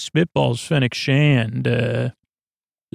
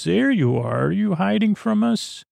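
put-on high, lilting coo to a baby; imitated baby talk; 8 semitones higher than usual. Woman to hiding Baby Oso, high imitated baby talk. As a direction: play someone sweetly finding a hiding child.

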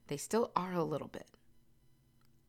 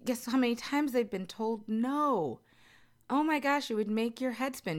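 ordinary talk

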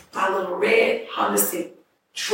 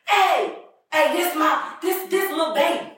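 put-on high-pitched drunken calling out; drunk woman's voice; 8 semitones higher than usual. Drunk woman introducing little baby to partiers, high drunken voice. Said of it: "with her drunk voice".

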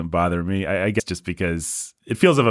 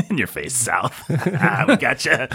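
teasing taunt; 6 semitones higher than usual. Pretend. Mocking the South, laughing.

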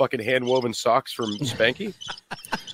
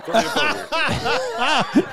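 laughing plea; 14 semitones higher, much slower than usual. Real, not acted. Pleading after correcting himself.

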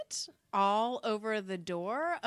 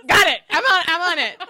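eager; 7 semitones higher, 16 dB louder than usual. Retold or imitated. Quoting her eager self.

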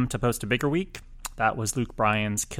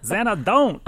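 playful wordplay; 8 semitones higher, 6 dB louder, slower than usual. Real, not acted. Wordplay on Xanadu.

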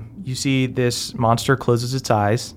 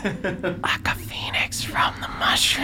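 whispering; 9 semitones higher, 5 dB quieter than usual. Acted.

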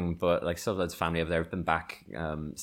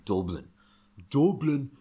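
drilling "Dublin" in a put-on Irish accent, higher and louder; practised Dublin accent; 7 semitones higher, 5 dB louder than usual. Boy at the mirror practising his Dublin accent. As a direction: rehearse "Dublin" over and over like a kid practising. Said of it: "Sat in front of the mirror".

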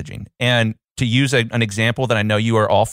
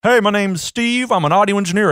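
hammed-up, higher-pitched audio engineer pitch; mock self-introduction; 9 semitones higher than usual. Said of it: "Their messaging is".

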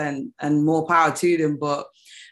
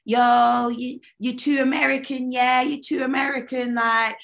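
higher-pitched mock complaint; imitated critics; 7 semitones higher than usual. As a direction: lightly mimic the people who keep telling you off. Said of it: "I've been told, like".

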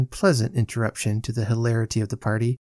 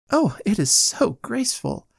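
put-on high-pitched admiring young lady; gushing; 10 semitones higher than usual. A narrator's reading. Young lady admires skating to Winkle, gushing. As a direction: gush with delight, pitch lifted.

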